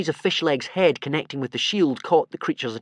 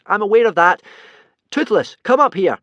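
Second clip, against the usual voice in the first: loud, high strained call; high loud call for help; urgent call; 9 semitones higher, 10 dB louder than usual. Acted. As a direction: yell up to the dragon. Calling dragon while being squeezed.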